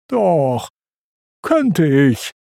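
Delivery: mildly wailed protest; understated hurt comeback, falling; Pumba wailing. A narrator's reading. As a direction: protest with a touch of a whine. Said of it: "heulte Pumba".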